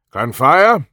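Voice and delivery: loud call to a passenger. A narrator's reading. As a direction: call it out from the driver's seat. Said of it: "the driver called".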